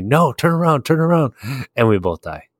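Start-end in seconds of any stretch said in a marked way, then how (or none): 0.0-1.3 urgent mock yell, pitched high; imitating Richie's yelling. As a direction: mimic a friend's frantic warning, voice pitched up. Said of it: "as Richie's yelling at me".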